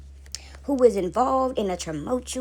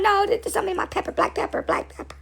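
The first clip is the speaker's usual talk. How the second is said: high mock-tearful excuse dropping to a whisper; hammed-up weepy mother; denying tears; 9 semitones higher than usual. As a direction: cover up crying, voice giving out. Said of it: "Mama, you crying?".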